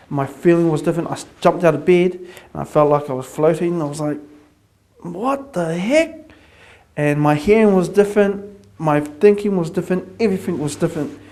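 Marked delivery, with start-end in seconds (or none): 5.0-6.3 slow, high, drawn-out amazement; slow baffled wonder; stunned disbelief. Retold or imitated. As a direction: gape at the strange new feeling. Waking up feeling changed.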